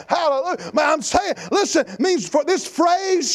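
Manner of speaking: high, forceful pulpit shout; preaching at full force. Acted. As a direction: drive each point home to the congregation.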